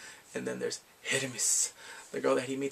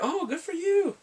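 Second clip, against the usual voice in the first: high, encouraging squeal; mock cheering; 14 semitones higher, slower than usual. Online friends hearing his story, mock cheering. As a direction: pitch it way up, congratulating him.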